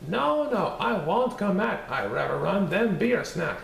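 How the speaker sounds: high, sing-song taunting cookie voice; bouncy little gingerbread man bragging; cheeky gingerbread man. Acted. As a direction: tease them from far out of reach. Gingerbread man refuses again.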